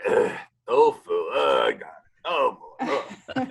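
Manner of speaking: mock-disgusted chefs groaning at tofu; hammed-up revulsion, groaning each word; disgusted groans.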